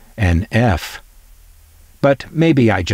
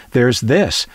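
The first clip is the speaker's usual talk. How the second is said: ordinary talk, nothing marked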